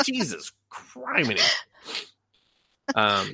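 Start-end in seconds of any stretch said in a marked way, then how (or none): 0.1-1.4 jesus criminy. Retold or imitated. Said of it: "They were like".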